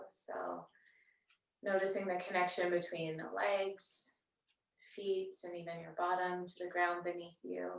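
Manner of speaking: soft, unhurried yoga-teacher voice with long pauses; guided meditation. Real, not acted. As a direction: gently guide them into settling and noticing the breath. Leads seated breathing meditation.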